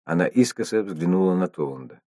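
ordinary delivery

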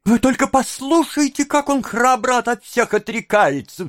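high, outraged woman's exclamation; crying out. A narrator's reading. Young woman appealing to onlookers, outraged cry. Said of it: "вскричала молодая женщина".

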